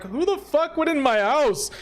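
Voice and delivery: high-pitched indignant homeowner; acted outrage. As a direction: wail it in hammed-up outrage. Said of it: "And he's like".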